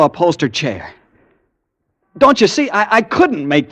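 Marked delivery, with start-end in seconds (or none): 2.2-3.7 desperate plea. Acted. Desperate plea to doubtful cop.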